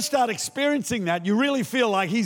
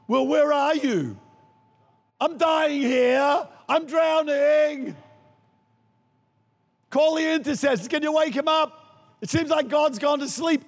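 mock panicked cry; 5 semitones higher than usual. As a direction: play the drowning believer crying out to God. Mock panicked believer crying out.

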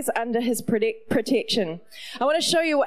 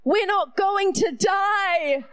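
put-on excited boy; 5 semitones higher, slower than usual. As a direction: shout it out like a kid who just got saved. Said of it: "And he goes".

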